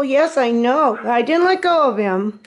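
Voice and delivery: ordinary talk, nothing marked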